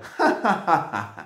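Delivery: prankster's staccato cackle of triumph; mocking laugh. A narrator's reading. Shepherd boy mocking his fooled friends, acted laugh. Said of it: "él se reía".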